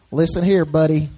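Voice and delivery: light cowboy accent, mock; country and western. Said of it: "Some of your country and western".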